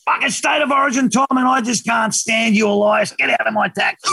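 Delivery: high-pitched ranting cabbie, not shouted; mock angry driver. Cab driver ordering rugby player out, mock angry rant. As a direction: imitate a fed-up driver throwing someone out.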